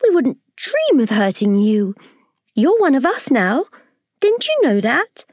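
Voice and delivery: very high, gentle reassurance; kindly ladybird. Acted. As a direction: soothe the scared boy. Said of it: "the ladybird said kindly".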